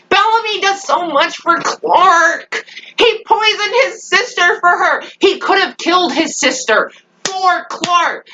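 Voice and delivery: high, distressed fan wail; exasperated outcry. Real, not acted. Fan defending Bellamy's sacrifices, high exasperated outcry. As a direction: plead his case in a high, exasperated voice.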